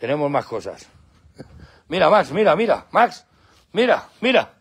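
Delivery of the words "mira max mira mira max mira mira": higher, repeated calls to a dog; excited coaxing. Real, not acted. Owner trying to get Max's attention, excited. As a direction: urge the dog to look, pitched up.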